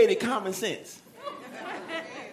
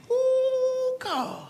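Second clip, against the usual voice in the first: acted awe, pitched way up; reverent ooh; 14 semitones higher than usual. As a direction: sigh it out high, then drop. Acting the people's reverent response.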